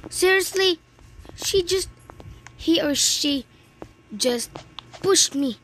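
real exasperated cry of unfairness; frustrated outburst. Real, not acted. Pushed off by another player.

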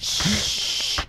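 long unvoiced shush. Real, not acted. Gamer hushing the others, long shush. Hushing sound during game.

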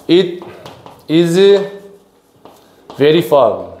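slow dictation while writing it out; word-by-word dictation. Shown as a demonstration. Teacher writing out an example for students, word-by-word dictation. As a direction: say it word by word, long gaps between.

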